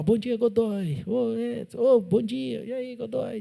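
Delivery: high, bright mock greetings; a hint of cheerful hallway greetings; coworkers greeting. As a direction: lightly voice the friendly coworkers calling hello.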